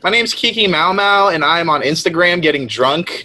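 awful customer service voice. Retold or imitated. Mocking how Allie would do the video, service voice. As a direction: recite it like a service rep parody. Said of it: "God-awful customer service voice".

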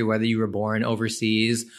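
ordinary talk, nothing marked

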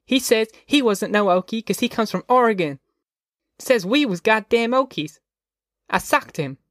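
put-on high-pitched indignant child; boy complaining to mother in a high voice; outraged young boy. A narrator's reading. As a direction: pitch it up like an offended little boy. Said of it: "in an outraged voice".

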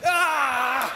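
loud playful "ah"; mock cry. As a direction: let out a hammed-up falling-over yell. Mimes falling out for laughs.

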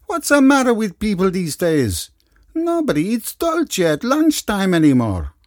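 put-on high, loud Italian-accented grumble; a portly padrone airing his grievance; Italian restaurateur complaining. Gianni's voiced lines.

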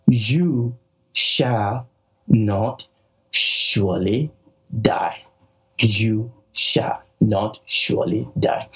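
very slow, word by word, with pauses; drawn-out, emphatic contradiction; forceful reply. Retold or imitated. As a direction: set every word apart for force. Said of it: "see the force of Satan's reply".

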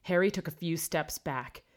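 plain reading voice

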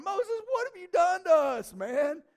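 loud, high-pitched protest to Moses; griping outburst. Acted. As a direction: play the fed-up crowd, voice jumping up loud. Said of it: "got through griping about all the stuff".

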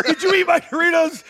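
high-pitched imitated confrontation; hammed-up accusation; mock accusing. Karen confronts him over eaten Doritos.

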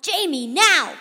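very loud, high urgent command; urgent shout. A narrator's reading. Boy urging Jamie to dash from the sphere, urgent shout. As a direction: shout at her to move immediately. Boy urges girl to run.

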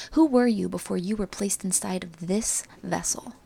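ordinary talk

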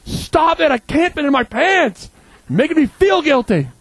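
high, loud frustrated protest; exasperated rant. Retold or imitated. Guest refusing the host's food, loud exasperated rant. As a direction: push back hard, fed up. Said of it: "I'm like".

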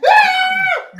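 high piercing mock scream of terror. Podcaster acting out a contestant's panicked scream. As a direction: scream like bugs are raining on you.

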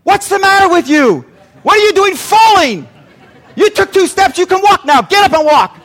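loud, high hammed-up berating; mock scolding. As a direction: yell at a toddler as an exasperated drill. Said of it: "none of us would have said".

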